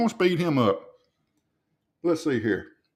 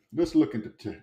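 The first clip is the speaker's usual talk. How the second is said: ordinary delivery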